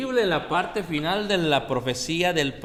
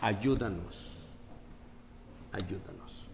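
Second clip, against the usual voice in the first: fading plea; 4 semitones lower, 6 dB quieter, slower than usual. Retold or imitated. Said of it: "le gritaban".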